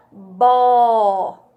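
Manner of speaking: BÓ loud and held long; syllable shown alone. Shown as a demonstration. Teacher showing children a syllable, "bó" long and loud. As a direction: stretch "bó" out as one syllable. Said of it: "a sílaba".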